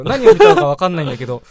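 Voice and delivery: ordinary talk, nothing marked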